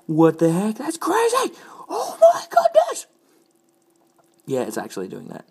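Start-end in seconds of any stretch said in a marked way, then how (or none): 0.1-3.1 high, loud squealing delight; excited shriek. Real, not acted. Vlogger shrieking at her changing mug, excited squeal.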